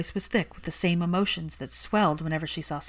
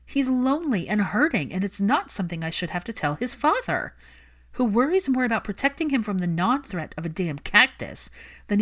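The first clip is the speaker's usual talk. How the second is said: higher, louder furious plea; angry Hermione; 5 semitones higher, 4 dB louder than usual. Acted. Hermione scolding Malfoy for neglecting his son, heated. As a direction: defend the lonely child fiercely.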